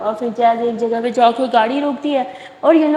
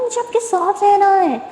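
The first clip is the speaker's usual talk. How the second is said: put-on childlike plea; 8 semitones higher than usual. A narrator's reading.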